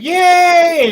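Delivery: put-on high, loud kids' cheer; children cheering. Parade children cheering, high and loud. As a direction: cheer like a crowd of kids. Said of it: "children and orphans going".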